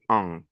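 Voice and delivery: the vowel en held alone and nasal. Shown as a demonstration. Teacher giving students the nasal vowel on its own. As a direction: isolate the sound en, fully nasal. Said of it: "N'oubliez pas la règle".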